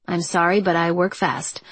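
plain reading voice

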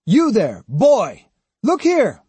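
loud, high surly call. A narrator's reading. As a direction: bark it at a boy to summon him. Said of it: "came a surly voice".

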